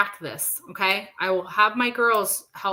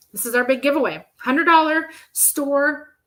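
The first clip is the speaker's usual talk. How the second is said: raspy whisper; 4 semitones higher, 5 dB louder than usual. Real, not acted. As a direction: voice giving out on her. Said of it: "I'm losing my voice now".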